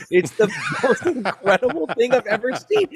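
giggling, high and delighted; laughing. Real, not acted. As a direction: laugh your way through it. Laughing at Trump trading cards.

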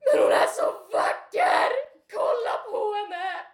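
high, very loud strained shout; retold screaming. Drunk man screaming about her from very close, strained high yell. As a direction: bellow it right in the face.

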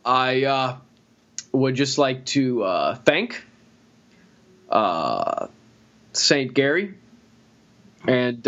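a lightly done, slow, pausing Obama at a podium; Obama impression. Joking about knighting Gary, a halting Obama-style thank-you. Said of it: "I can't do an Obama impression".

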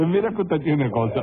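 hablando con la risa en la voz; entre risas. Acted. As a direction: dilo riéndote del disparate. Juez se ríe del acusado. (divertido, aún riendo)

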